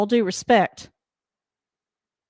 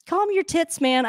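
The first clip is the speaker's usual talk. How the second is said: mocking retort; 7 semitones higher than usual. Real, not acted. Yelling back at coach on TV.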